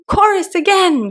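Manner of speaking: high eager call; cried out. Acted. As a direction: call for the chorus. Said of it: "cried the Gryphon".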